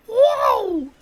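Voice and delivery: very high, loud gargled whoa; gargly Crash whoa. Shown as a demonstration. Imitating Crash Bandicoot, a high gargly whoa. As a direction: gargle out a cartoon yelp. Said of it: "a gargly whoa".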